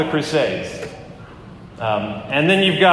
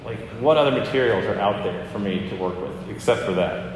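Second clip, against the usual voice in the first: lightly voiced God, softer and lower, dry and matter-of-fact; a hint of a patient God shrugging back; God's reply, softer and lower; 4 semitones lower, 4 dB quieter than usual. Preacher voicing God answering his complaint, softer and lower. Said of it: "And I think God responds".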